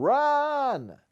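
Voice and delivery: high, urgent yell of run; yelled warning. Acted. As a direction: shout it as you drag him away. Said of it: "pulled me up by my sleeve and yelled".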